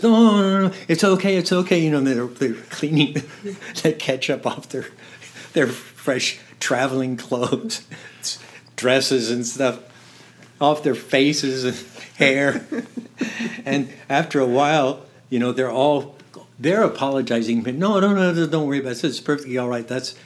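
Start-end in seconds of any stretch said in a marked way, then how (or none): 0.0-1.8 higher, louder, gushing no-no-no; mock reassurance. Splattered diners eagerly reassuring the apologizing sheik, high and loud. 17.7-19.9 starts higher and louder, settles to polite; eager no-no-no easing down; mock reassurance. Diners brushing off his apology, gushing then calming.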